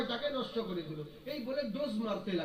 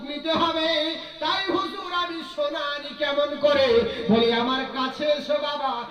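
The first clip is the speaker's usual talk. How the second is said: loud, high, slow preaching; preaching at full force; 4 semitones higher, 6 dB louder than usual. Acted. Preacher acting out the master and disciple, full force. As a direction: hammer every line to the crowd.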